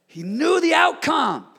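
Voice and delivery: preacher shouting at full force; shouted. Acted. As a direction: burst out with it. Sermon shout.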